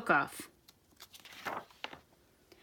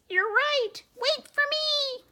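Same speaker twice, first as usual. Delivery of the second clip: much higher, scolding squeak; squeaky mouse voice; 14 semitones higher than usual. Acted. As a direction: taunt the troops in a tiny voice. Commander runs after troops.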